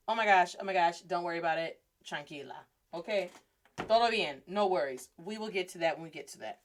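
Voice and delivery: calm the worried student down; reassuring. Retold or imitated. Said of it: "calming the student".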